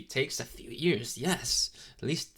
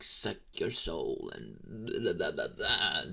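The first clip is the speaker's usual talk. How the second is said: lightly hammed-up, lower demon voice; mock sinister demon; 5 semitones lower, slower than usual. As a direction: drop low, poking fun at a scary soul-sucker. Mocking humans' dramatic idea of demons.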